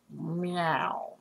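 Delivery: lazy cat meow, soft and low; a lioness playfully meowing. Pretend. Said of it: "my female lioness".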